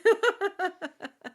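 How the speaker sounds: loud, high burst of laughter; real high-pitched cackling laugh. Real, not acted. Laughs at child's interjection.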